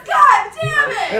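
very loud, high, drawn-out curse; exasperated mock outburst. Driver hit by passenger's puke, exasperated yell. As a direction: bellow it as the outraged driver.

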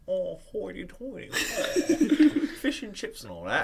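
pompous British caricature, broken by laughing; mock British accent. Friend predicts how he'll come back, mock British accent. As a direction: put on a hoity-toity British voice. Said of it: "you will have a British accent".

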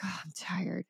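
soft, sighed acted answer; weary reply. Imagined person answers how are you, weary reply. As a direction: let it drop away like a worn-out habit.

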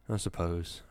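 glum, resigned reply; miserable. A narrator's reading. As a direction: say it with a sigh of misery. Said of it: "Wisconski said miserably".

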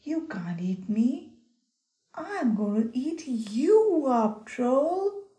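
loud, defiant big goat; bold shout. Acted. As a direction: roar it back at the troll.

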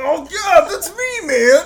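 high bragging yell; proud shout. Pretend. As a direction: shout it out with pride. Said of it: "You're like".

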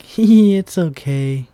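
laughing it off, high and loud; giggly. Retold or imitated. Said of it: "I was like".

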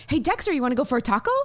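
high, quick, eager voice to the dog; same bouncy tone, different words; same excited pet talk. Shown as a demonstration. Owner asks her dog about a taco in walk tone, excited. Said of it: "he would still get excited".

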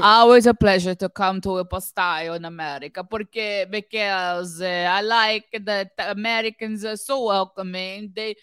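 hammed-up Italian accent, halting English; fake Italian accent. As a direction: do a horrible fake Italian singer. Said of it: "putting on a horrible fake Italian accent".

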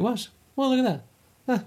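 squeaky high surprised tone; high-pitched voice. Real, not acted. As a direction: voice jumping way up. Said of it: "Makes my voice go high".

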